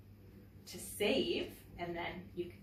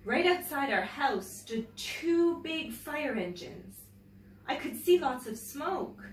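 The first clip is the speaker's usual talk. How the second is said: louder, slower telling of the fire; dramatic story reading; 6 semitones higher, 3 dB louder, slower than usual. A narrator's reading. Teacher reading the fire scene to children, slower and louder. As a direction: build the tension of the fire.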